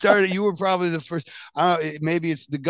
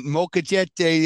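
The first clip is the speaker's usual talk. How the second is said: slow, higher Saskatchewan take on the word; Saskatchewan accent; 5 semitones higher, much slower than usual. Retold or imitated. Joking how locals would order, slow Saskatchewan accent. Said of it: "If you're in Saskatchewan".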